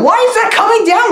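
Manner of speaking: high, fast incredulous question; mock bafflement. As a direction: blurt it out in hammed-up disbelief. Acting out film's bafflement.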